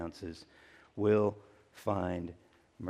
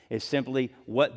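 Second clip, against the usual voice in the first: usual speaking voice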